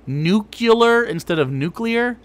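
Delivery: first Nuclear said as nucular, second as new-clee-er, slowly; nucular versus nuclear. Shown as a demonstration. Mocking mispronouncers: nucular, then nuclear.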